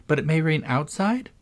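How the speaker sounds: put-on high, light girl's question; lightly voiced inquiring girl; questioning Alice. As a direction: ask with a lifted, doubtful pitch.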